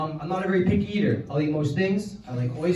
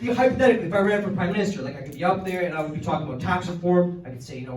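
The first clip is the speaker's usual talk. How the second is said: ordinary talk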